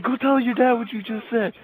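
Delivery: high, mock-angry order; scolding grandpa. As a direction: play a worked-up grandfather sending the kid off.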